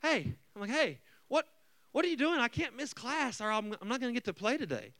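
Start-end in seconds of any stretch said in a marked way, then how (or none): 0.0-0.3 bad mood. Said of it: "I'm already in a bad mood". 0.7-4.9 high-pitched indignant protest; whiny mock teenage complaint, pitched up; smart aleck. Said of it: "I'm kind of a little s-, smart aleck".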